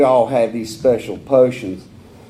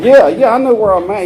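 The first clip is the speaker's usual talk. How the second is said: laughing insistence; 9 semitones higher, 7 dB louder than usual. Retold or imitated. Insisting to rehab guy, laughing.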